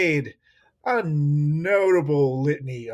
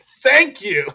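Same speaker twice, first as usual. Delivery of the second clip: mock grateful thanks; 10 semitones higher, 10 dB louder than usual. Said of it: "And I was like".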